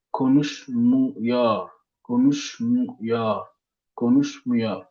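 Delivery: konuşmuyor drawn out very slowly; slow Turkish demo. Shown as a demonstration. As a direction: sound out konuşmuyor slowly for the class. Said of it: "Donc ici". (konuşmuyor prononcé très lentement)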